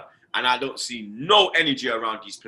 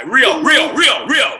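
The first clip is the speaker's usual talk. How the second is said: shouting out; 11 semitones higher, 9 dB louder, much slower than usual. Real, not acted. Calling out to Rio Ferdinand.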